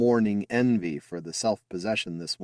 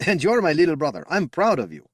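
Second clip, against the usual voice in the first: laughing pride; 9 semitones higher, 6 dB louder than usual. Acted. Brother praising, laughing.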